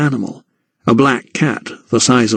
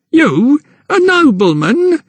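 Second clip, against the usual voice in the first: much higher, slow jeering crookback; mocking; slower than usual. A narrator's reading. Crookback mocks the count, high jeer. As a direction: sneer at the little count. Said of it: "shrill laugh and pointed a mocking finger".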